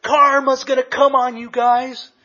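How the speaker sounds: high, loud imitation of a screaming mom; retold angry scream. Mad mom screaming at rivals for a parking spot, imitated scream. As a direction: yell it high as mock fury. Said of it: "she's screaming and screaming, and she goes".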